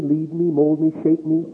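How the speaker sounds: usual speaking voice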